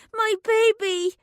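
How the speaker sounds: put-on high, loud child's shriek. A narrator's reading. The little girl shrieks for her baby, high and loud. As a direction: shriek for the lost doll. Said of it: "shrieked the little girl".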